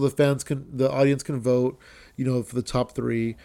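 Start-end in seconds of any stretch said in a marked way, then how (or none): none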